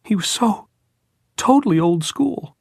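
put-on very high, grief-stricken boy; voice breaking. A narrator's reading. Jordan mourning the head at his grave, voice breaking. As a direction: let the voice break high and loud. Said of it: "finally broke".

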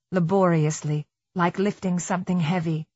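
plain reading voice

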